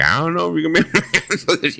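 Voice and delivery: retold warning half laughed out; laughing. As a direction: tease him while cracking up.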